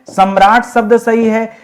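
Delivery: ordinary delivery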